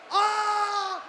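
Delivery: normal talking voice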